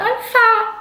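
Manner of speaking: high mock wail; imitating a tearful wail. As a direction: squeak it out like a retold sob. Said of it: "I just burst into tears".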